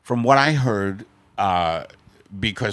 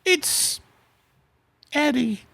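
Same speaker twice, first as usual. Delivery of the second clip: much higher falsetto wife voice; a shrill-free falsetto Margaret, bossy; high put-on wife voice; slower than usual. Husband teased by his wife, in a high falsetto wife voice.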